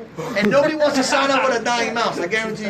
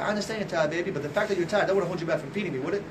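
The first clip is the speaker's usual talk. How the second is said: soft, low acted persuasion; coaxing husband; 3 semitones lower, 5 dB quieter than usual. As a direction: play a husband gently talking his wife round. Husband gently persuading tired wife.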